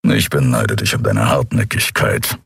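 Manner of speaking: low, gruff grumble of a big beast; grumbling Kero. Acted. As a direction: rumble it out low and grudging. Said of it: "brummte Kero". (tiefes, brummiges Grummeln)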